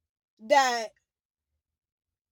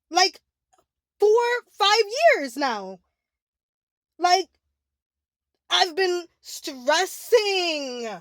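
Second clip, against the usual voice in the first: higher, louder and strained, slowing down as the words break; distressed, near tears; 5 semitones higher, 4 dB louder than usual. Real, not acted. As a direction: pour out years of dread, close to tears. Breaking down over years of fear.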